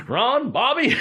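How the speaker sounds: high, startled call of a friend's name; spotting an old friend out of nowhere; surprised greeting. Retold or imitated. Surprised to meet friend at airport.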